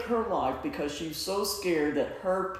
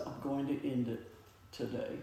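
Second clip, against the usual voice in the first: softer, mildly imitating a despairing girl; hushed despairing quote; 4 semitones lower, 9 dB quieter than usual. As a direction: let it sink to a near hush. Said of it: "she's just sobbing, sobbing".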